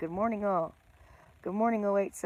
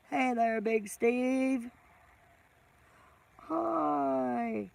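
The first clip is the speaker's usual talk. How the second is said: ordinary talk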